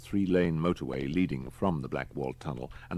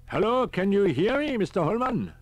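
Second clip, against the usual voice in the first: put-on high, fussy elderly professor; a high old professor calling on the radio; professor's voice; 7 dB louder than usual. A narrator's reading. Riker on the radio.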